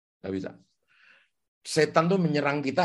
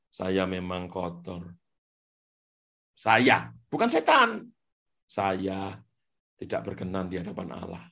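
inner self-accusing voice; 10 semitones lower, 3 dB quieter than usual. Retold or imitated. Voice in the listener's head confessing shame, low and soft. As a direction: sink into quiet shame. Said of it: "ada suara di pikiranmu begini".

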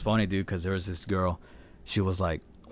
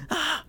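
mock scream. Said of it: "And then she screamed".

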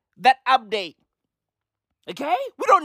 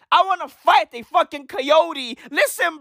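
ordinary talk

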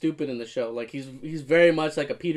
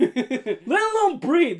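mock rant; 12 semitones higher, 4 dB louder, much slower than usual. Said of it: "And I'm just like".

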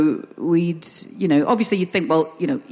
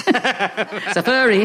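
mum's voice, laughing; 2 semitones higher, much slower than usual. Retold or imitated. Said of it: "I woke up to them having a little chat".

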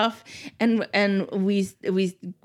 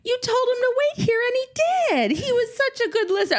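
high, fast, gushing mom voice; other party mom; 13 semitones higher, faster than usual. Retold or imitated. Mother retelling a party, voicing another mom's praise, high and gushing. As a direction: gush praise like a sweet, chatty mom, high and quick. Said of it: "some other mom from the party who was like".

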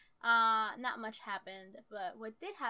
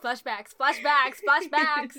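rising, ever louder chant; hammed-up flashback alarm; mock-dramatic cry; 4 semitones higher, 14 dB louder, slower than usual. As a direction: build each word into a mock-panicked shout. Mock-dramatic cue into the memory.

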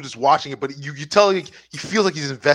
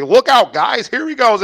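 mock announcer warning; 7 semitones higher, 6 dB louder than usual. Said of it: "he'd be".

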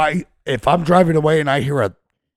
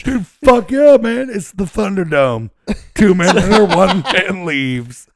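much higher and louder, laughing through it; excited shout; 6 semitones higher, 4 dB louder, slower than usual. Real, not acted. Cheering the Thunderdome joke to his friends, excited laughing shout. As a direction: hype it up like a fight announcer, cracking up.

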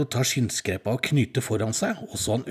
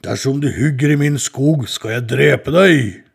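loud, slow threatening troll; 3 semitones higher, 8 dB louder than usual. Acted. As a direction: boom it out like a menacing troll. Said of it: "kom trollet til ham med og sa".

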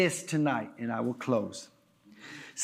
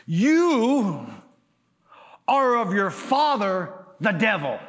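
bold, raised-voice rebuke with shoulders back; higher and louder, squaring up to accusers; Jesus; 3 semitones higher, 4 dB louder than usual. Acted. Jesus answering the leaders questioning him, bold rebuke. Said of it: "I could see him put his shoulders back".

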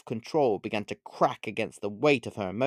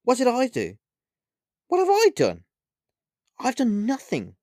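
high-pitched, indignant disbelief; surprised; 12 semitones higher, 5 dB louder than usual. A narrator's reading. Olivia protests the witch's blame, surprised. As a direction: protest in a much higher voice. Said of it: "Olivia replied with surprise in her voice".

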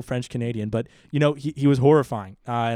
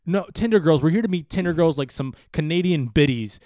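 insistent driver; 5 semitones higher, 3 dB louder than usual. Retold or imitated. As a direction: spell it out to the baffled guard. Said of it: "Fish is just like".

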